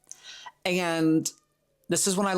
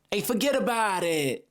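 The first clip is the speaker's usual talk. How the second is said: high, blunt New Yorker impression; mock New York accent; 7 semitones higher than usual. Imitating direct New Yorkers, blunt New York accent. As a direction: cut right through in a New York accent. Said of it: "people from New York are just direct".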